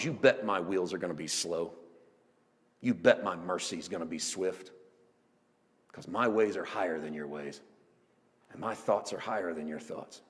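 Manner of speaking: low, hushed assurance; God's solemn promise. Acted. Preacher voicing God's promise of mercy, quiet and low. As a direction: drop down low and soft, measured phrases.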